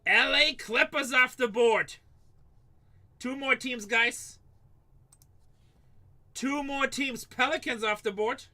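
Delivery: high, loud, slow call to the stream; rally the viewers to buy the last teams; excited announcing. Real, not acted. Breaker hyping viewers, excited loud high call.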